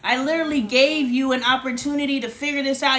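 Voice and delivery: normal talking voice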